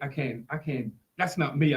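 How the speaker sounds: ordinary talk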